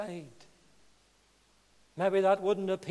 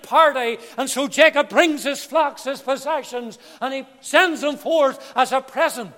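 loud, raised, ringing pulpit voice; full-force preaching; 6 semitones higher, 15 dB louder than usual. Acted. Preacher urging sinners to peace with God, full-force preaching. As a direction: proclaim it with fervour, driving key words hard.

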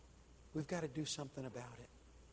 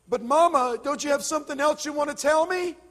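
desperate plea; 13 semitones higher, 15 dB louder than usual. A narrator's reading. Son begs mother.

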